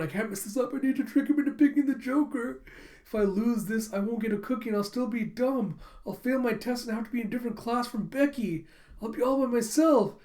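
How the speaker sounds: high-pitched mock panic; freaking out. Girl fears losing the card game, panicky. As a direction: spiral into worry, pitch staying up high. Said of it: "freaking out".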